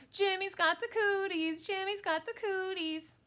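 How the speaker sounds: high, loud playground chant; high-pitched girl's mocking yell; yelled taunt. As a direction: tease him in a yelled chant. Same yelled taunt at another boy.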